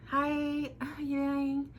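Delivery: mock high, sugary greeting; fake sweet voice. Mocking the fake-friendly greeting, high and sweet. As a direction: put on a fake bright high voice. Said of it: "I'm not going to fake and pretend".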